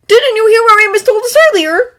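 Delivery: high, loud angry shout. A narrator's reading. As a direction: shout it in frustration. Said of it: "Draco shouted".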